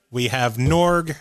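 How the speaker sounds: usual speaking voice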